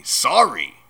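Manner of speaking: loud, incredulous bark; indignant bark. A narrator's reading. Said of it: "barked Hagrid".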